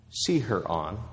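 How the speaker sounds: usual speaking voice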